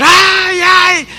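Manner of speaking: high, drawn-out mock wailing; imitated scream. Wife crying out as her husband hits her, mock scream. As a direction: cry out like someone being beaten, held high. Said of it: "empezó a gritar".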